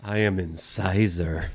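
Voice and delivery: low, slow self-introduction; deep character voice. Pretend. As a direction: announce yourself grandly and slowly. Voicing a tooth character.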